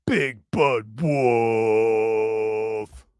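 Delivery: very slow, low, stretched-out last word; long low drawl. Real, not acted. Drawn-out name, slow and low.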